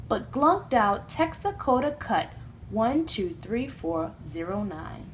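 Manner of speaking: plain reading voice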